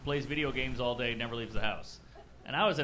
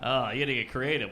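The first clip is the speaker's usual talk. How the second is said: normal talking voice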